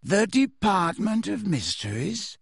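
slow, high, dreamy question; placid, wondering surprise; mildly surprised. A narrator's reading. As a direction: echo it airily with a rising lilt. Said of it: "looking mildly surprised".

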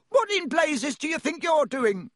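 high-pitched indignant yell from a guard; angry yell. A narrator's reading. Guard yells at two boys who crashed their trolleys, angry high yell. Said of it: "a guard nearby yelled".

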